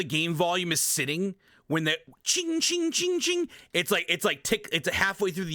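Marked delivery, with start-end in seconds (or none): 2.2-3.5 high ringing chings; imitated attack clanging. Streamer mimicking the game's magic attacks, imitated clanging. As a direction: mimic clashing attack sounds.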